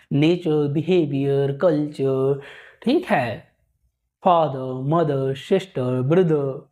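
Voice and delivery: non-rhotic endings; silent final r. Shown as a demonstration. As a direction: drop the r in नेचर and ब्रदर. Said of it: "ऐसे बोलना होता है".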